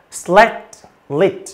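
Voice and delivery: "slat lit" as two separate words, the t sounded; careful, separated "slat lit"; clearly released t. Shown as a demonstration. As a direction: pause after "slat", releasing its final t. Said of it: "Đọc rõ âm tờ".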